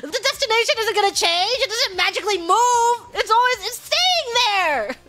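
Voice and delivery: high-pitched exasperated explaining. Retold or imitated. Daughter explaining the U-turn to mom, exasperated. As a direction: plead the obvious, at the end of your patience. Retold quarrel with driver mom.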